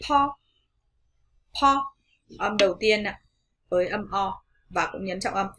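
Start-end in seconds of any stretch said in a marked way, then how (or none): 0.1-1.9 "po" higher, open short o; stressed po. Shown as a demonstration. Teacher drilling the first syllable po. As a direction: say "po" with mouth wide. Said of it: "chúng ta sẽ đọc".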